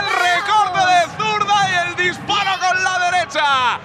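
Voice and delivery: rising shouted goal commentary; breakneck goal commentary. Real, not acted. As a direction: call the goals at full tilt.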